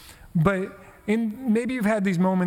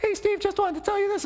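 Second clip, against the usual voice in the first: put-on much higher, a young boy before his voice breaks; squeaky boy voice. Pastor imitating a middle schooler's squeaky greeting, high boyish voice. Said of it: "he had this kind of high, squeaky voice".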